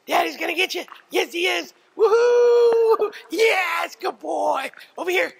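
very high, loud excited dog play voice; calling from far away. Real, not acted. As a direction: squeal praise at a playful dog. Owner plays with dog, praising.

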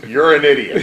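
loud mock-angry outburst; retold angry put-down; angry boss. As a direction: blurt it loudly as an imitated boss hanging up. Boss slams phone down after it.

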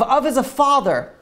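usual speaking voice

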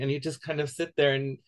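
ordinary talk

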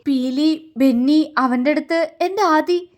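broken phrases, climbing in pitch; faltering words. A narrator's reading. Ayush telling Paru who has his Aadi, faltering. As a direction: falter with overwhelmed emotion. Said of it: "ഇടറുന്ന അവന്റെ വാക്കുകൾ".

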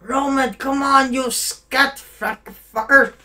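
high, exasperated call, slowing on the insult; mock impatient call. Player urging on his slow game cousin, high mock exasperation.

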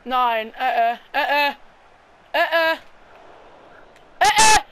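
stammering, building to a high yelp; rising panicked stammer. Real, not acted. Panicked goal defence in match.

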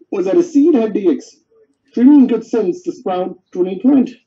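usual speaking voice

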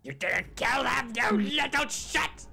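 high, loud, drawn-out screech; mock furious screech, stretched out; angry ghost impression. Player mimics the vengeful ghost scolding, mock enraged screech. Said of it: "that's what she said".

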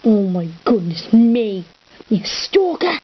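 loud hammed-up accusation; loud mock accusation. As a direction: yell it as a joke.

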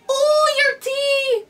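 put-on high, slow, loud crying old man; weepy old man voice. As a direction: whimper it like a weepy grandpa. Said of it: "turning to an old man".

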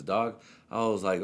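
ordinary talk, nothing marked

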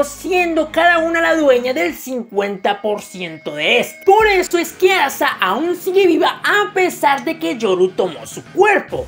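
ordinary delivery

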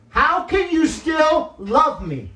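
1 anguished plea. Retold or imitated. Pleading with God in retold prayer.

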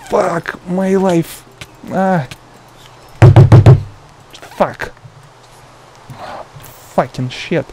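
slow drawn-out frustrated swearing; raging gamer. Real, not acted. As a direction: vent at the game dying again. Dying repeatedly in game.

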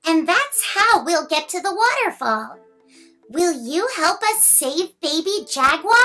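ordinary delivery

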